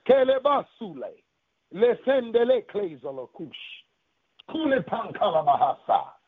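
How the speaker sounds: fervent run of unknown syllables; praying in tongues. Real, not acted. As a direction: pour out rhythmic tongues between pauses.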